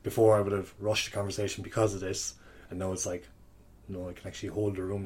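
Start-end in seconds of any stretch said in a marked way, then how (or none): none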